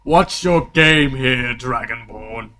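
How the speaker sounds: slow, loud demand; challenging king. Acted. Game master voicing the king confronting a fighter, loud challenge. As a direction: call the player out, drawing it out.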